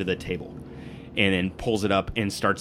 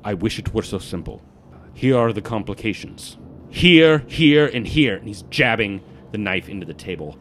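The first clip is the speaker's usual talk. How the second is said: measured, emphatic briefing with loud key words; intense guild informant; 5 dB louder than usual. Acted. Warns the party about security stones, intense informant. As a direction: spell out the dangers with deliberate force.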